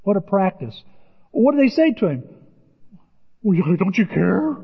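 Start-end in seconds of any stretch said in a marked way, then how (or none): 3.4-4.6 reproachful plea. Retold or imitated. Disciples reproach Jesus.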